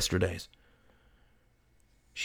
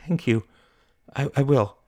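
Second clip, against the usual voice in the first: higher, hesitant thanks; shy young customer; 6 semitones higher than usual. Acted. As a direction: stumble slightly, pitched up and grateful. Mary shyly thanks the vendor.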